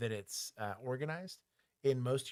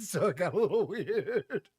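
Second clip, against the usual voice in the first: laughing; 8 semitones higher, 8 dB louder than usual. Real, not acted. Laughing at odd memory.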